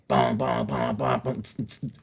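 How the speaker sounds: thumping bum-bum beat, then hi-hat tss; imitated techno beat. Shown as a demonstration. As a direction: mouth out a fast hardcore techno beat. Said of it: "a hundred eighty beats per minute".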